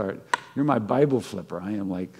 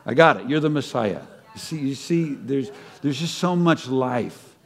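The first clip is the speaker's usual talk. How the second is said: usual speaking voice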